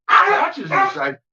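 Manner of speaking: high, excited pet talk; high-pitched fussing over the dog. Real, not acted. Excitedly talking about the barking dog.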